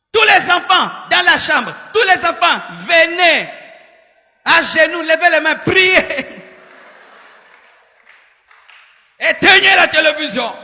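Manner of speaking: high, loud commanding call to prayer; roaring rallying speech. Retold or imitated. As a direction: order the whole house to kneel. Preacher ordering family to pray.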